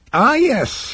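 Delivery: high-pitched, polite regret; regretful psychiatrist. Acted. Psychiatrist hearing his client is aboard, politely regretful. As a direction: play the smooth therapist briefly troubled. Psychiatrist's smile flickers at news.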